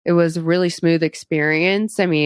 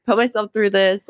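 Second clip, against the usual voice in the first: imitated nerves; 5 semitones higher, faster than usual. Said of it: "I was just like".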